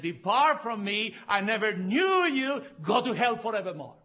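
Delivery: thunderous, high, loud condemnation; God in judgment. Acted. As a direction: pronounce the sentence as a judging God. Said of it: "compared to a God who said".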